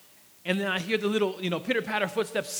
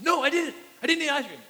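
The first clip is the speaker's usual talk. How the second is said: put-on high, loud little-boy denial; child's denial; 7 semitones higher, 4 dB louder, faster than usual. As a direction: insist like a caught toddler. Toddler denying eating ice cream.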